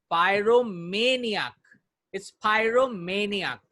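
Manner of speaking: slow pyromaniac with ma said as may; model pronunciation. Shown as a demonstration. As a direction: say pyromaniac slowly, ma like the month may. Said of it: "it's not मैं, it's may". (धीमे "pyromaniac", ma को may जैसा बोलें)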